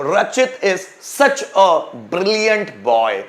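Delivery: very slow, each word spaced; slow dictation. Shown as a demonstration. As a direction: read it out slowly for students to write. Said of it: "teacher dictates example sentence".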